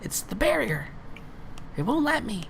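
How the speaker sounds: tiny high voice, softly; high-pitched creature. Acted. Creature explains the barrier to companion, high and small. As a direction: squeak out the excuse. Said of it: "She goes".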